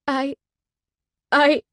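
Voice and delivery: choked. A narrator's reading. Heroine choking up before the two bikers. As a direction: voice catching, breaking high. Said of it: "I choke out".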